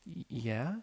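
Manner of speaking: nervous quiet yeah with a stammer; cautious. A narrator's reading. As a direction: ask warily, afraid of the answer. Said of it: "as she cautiously inquired".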